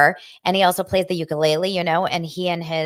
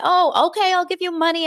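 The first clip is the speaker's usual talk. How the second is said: playful; 11 semitones higher, 5 dB louder than usual. Retold or imitated. Mom playfully answers son.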